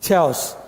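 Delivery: "chaos" said with a ch as in chair; mispronounced chaos. Shown as a demonstration. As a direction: say "chaos" wrongly, starting "ch" like church. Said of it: "Not chaos. It's chaos".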